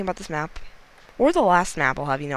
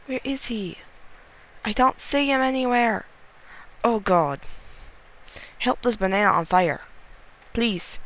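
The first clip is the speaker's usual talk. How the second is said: panicking; 7 semitones higher than usual. Real, not acted. Banana on fire begging to find the last player, frantic. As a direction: plead with rising panic. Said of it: "I very panicking".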